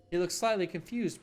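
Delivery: normal talking voice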